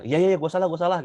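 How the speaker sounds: high, loud, impatient "iya, iya"; dismissive apology. Retold or imitated. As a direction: brush it off, quick and annoyed. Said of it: "kalau nadanya begitu". (minta maaf dengan nada ketus, asal-asalan)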